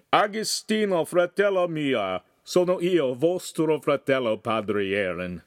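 put-on high, loud Italian greeting, heavily accented; accented shouting. A narrator's reading. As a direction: hail a brother loudly in Italian. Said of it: "he shouted in a heavy Cambrian accent".

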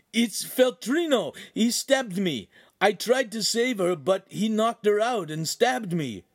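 high, insistent panicked voice; frantic report. A narrator's reading. Stabbed Ugolino explaining to his friends, high frantic telling. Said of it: "began to babble so quickly he was incoherent".

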